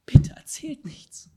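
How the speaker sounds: slow, forceful stage whisper; pleading whisper. Acted. Jesus begs the healed to tell nobody, urgent whisper. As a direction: whisper it hard, begging for secrecy. Acts out Jesus begging for secrecy.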